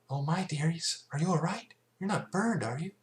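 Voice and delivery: fretting over hurt little ones; tender Fluttershy. A narrator's reading. Fluttershy checking the fillies for burns, tender.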